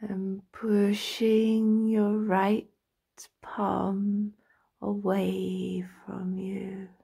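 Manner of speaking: slow meditation voice with drawn-out words and long pauses; guided relaxation. Acted. Practitioner talking a listener into lying down and stretching, slow guided meditation. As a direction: guide them through the body slowly, stretching each phrase.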